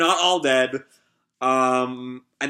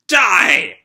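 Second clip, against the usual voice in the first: louder, fierce shout; a loud fighting shout; battle cry; 4 semitones higher, 7 dB louder than usual. Acted. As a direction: yell it as you open fire. Girl starts shooting everyone.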